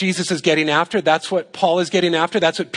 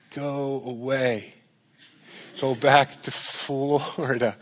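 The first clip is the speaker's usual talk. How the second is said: grumpy listener; 3 semitones lower, 7 dB quieter, slower than usual. Retold or imitated. Imagined listener's grumble.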